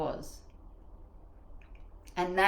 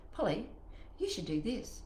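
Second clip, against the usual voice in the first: soft, quiet prompting; a quiet inner voice; gentle inner nudge; 8 dB quieter than usual. Acted. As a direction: say it softly, like intuition advising. Intuition voiced as inner advice.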